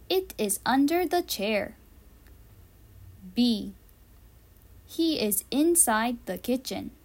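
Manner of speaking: ordinary talk, nothing marked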